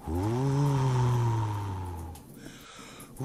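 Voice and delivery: long, eerie howling wind voice; wailing north wind. A narrator's reading. The north wind wails at the wigwam, long and eerie. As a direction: moan it out like winter wind. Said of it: "wailed the north wind".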